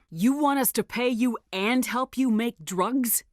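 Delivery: sarcastic, slowed-down sneer; mocking disbelief. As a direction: drag it out like it's absurd. Mocking Darius's demands.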